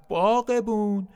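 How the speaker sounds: slow, high call across the garden; loud shout. Acted. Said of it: "با صدای بلند فریاد زد".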